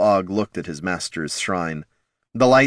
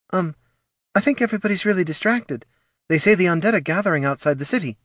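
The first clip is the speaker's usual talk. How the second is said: put-on nervous girl; 11 semitones higher, faster than usual. A narrator's reading.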